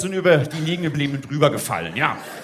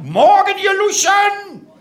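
high, drawn-out parade-ground shout; drill sergeant bellow; 11 semitones higher, 5 dB louder, slower than usual. Shown as a demonstration. Drill instructor coming on stage to greet recruits, bellowing. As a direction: roar the greeting slowly at the crowd. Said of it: "brüll die Leute an".

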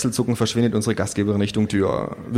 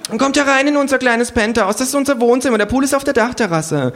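much higher, loud gushing host voice; trendy hostess; 14 semitones higher, 4 dB louder than usual. Acted. Hostess shows guests her penthouse, gushing high. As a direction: gush over the penthouse.

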